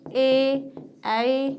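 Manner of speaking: usual speaking voice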